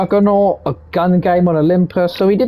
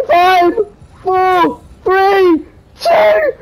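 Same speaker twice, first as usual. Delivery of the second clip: high, loud rising countdown; excited countdown; 14 semitones higher, 4 dB louder, much slower than usual. Real, not acted. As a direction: count down thrilled. Counting down to victory.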